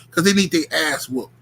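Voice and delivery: ordinary talk, nothing marked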